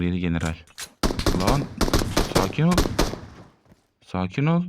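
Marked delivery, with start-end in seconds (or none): 1.4-4.6 rising mock-soothing "easy, buddy"; taunting calm down. Real, not acted. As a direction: tease the opponent to chill out. Taunting enemy in fight.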